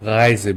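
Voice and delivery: "রাইজে" with the R made in the throat; throaty German R. Shown as a demonstration. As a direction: start "রাইজে" from deep in the throat. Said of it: "ভিতর থেকে আসতে হবে". ("রাইজে"-র র গলার ভিতর থেকে)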